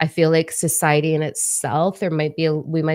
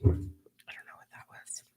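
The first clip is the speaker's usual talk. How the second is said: whispering to herself, sheepish; embarrassed whisper; 10 dB quieter than usual. Real, not acted. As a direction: mutter in a soft whisper. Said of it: "embarrassed aside".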